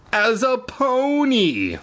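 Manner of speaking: high, slow dramatic reveal. A narrator's reading. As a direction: stretch out the reveal.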